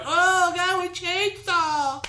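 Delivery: high, slow, drawn-out mock scream; mock frightened cry. Friend mocking scared visitors at the chainsaw guy, high and drawn out. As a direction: ham up a terrified park visitor. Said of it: "and you're like".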